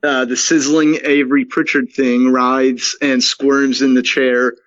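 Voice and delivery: ordinary talk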